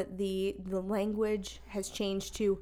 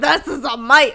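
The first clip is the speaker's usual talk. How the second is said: shouted Aussie impression, much louder; mock Crocodile Hunter; 4 semitones higher, 15 dB louder than usual. Quoting Crocodile Hunter to her friend in a loud Aussie accent. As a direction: bellow it like a croc hunter.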